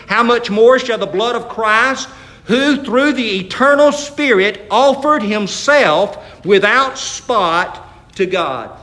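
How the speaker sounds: slow, projected preaching; scripture declamation. Real, not acted. Preacher reads Hebrews to congregation, slow and forceful. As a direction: proclaim it, stretching out the closing words.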